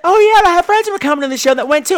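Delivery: high, fast, bubbly imitation of a castmate; mock chirpy girl. Mimicking Nancy telling the host her friends went too, high and bright. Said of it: "she's like".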